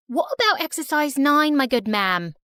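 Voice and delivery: put-on British accent. Student answering the teacher in class, unwitting British accent. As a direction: speak in a cheeky British accent. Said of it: "talking in a British accent".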